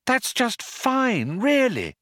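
very high, slow sing-song; lilting, humouring reassurance. A narrator's reading. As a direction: soothe with forced sweetness. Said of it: "lilted Trillian".

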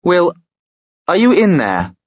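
high, loud call across a room. Acted. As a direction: call his name upward, searching. Calling through the door.

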